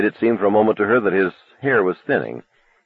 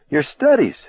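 pleading mother; 9 semitones higher than usual. Acted.

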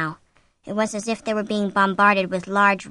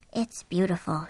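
murmured; 2 semitones lower, 6 dB quieter than usual. A narrator's reading. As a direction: murmur it quietly. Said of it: "she murmured".

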